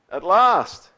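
Lucy sobbing his name as she runs to him. Acted. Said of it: "sobbed Lucy".

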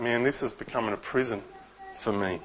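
soft, sinking murmur; quiet hopeless aside; despairing inner thought. Retold or imitated. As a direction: let it fall away in defeat. Voicing the listener's despairing thought.

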